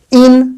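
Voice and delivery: "in" said alone as one syllable; teacher breaking off "in"; isolated syllable. Shown as a demonstration. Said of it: "इसको अगर मैं तोड़ूंगा".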